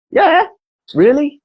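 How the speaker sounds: a hammed-up Noel, incredulous; mock disbelief. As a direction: play Noel not buying it.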